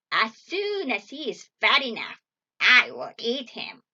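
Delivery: loud, menacing witch voice; commanding witch. A narrator's reading. Witch sends Gretel for water, loud wicked orders. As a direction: order her about loudly and wickedly. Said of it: "she cried".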